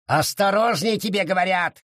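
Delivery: high-pitched angry warning, held-back volume; mock yell. A narrator's reading. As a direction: scold as if yelling. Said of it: "заорал его честь".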